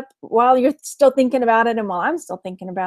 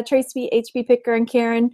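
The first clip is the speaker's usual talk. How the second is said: ordinary talk, nothing marked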